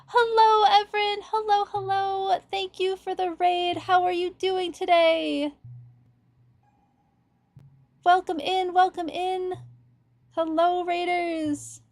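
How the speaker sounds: high bright welcome to raiders; excited greeting. Real, not acted.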